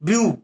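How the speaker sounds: ब्यू as one single syllable, its vowels run together; vowels blended together. Shown as a demonstration. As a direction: say ब्यू in one unbroken sound. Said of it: "इन तीनों को हम देखो एक साथ बोल दे रहे हैं". (ब्यू को एक साथ, एक ही ध्वनि में बोलना)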